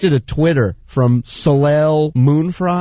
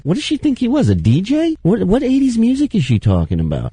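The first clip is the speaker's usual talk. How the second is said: high-pitched, baffled questioning; incredulous comic retort, pitched up; mock incredulity; 9 semitones higher than usual. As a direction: question it in exaggerated disbelief. Mocks the tweet as baffling.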